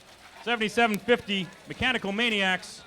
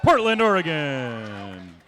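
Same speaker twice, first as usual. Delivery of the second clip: slow, drawn-out, louder hype intro; fighting game announcer; 5 dB louder, much slower than usual. Acted. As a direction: stretch every syllable like a ring announcer. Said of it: "game announcer".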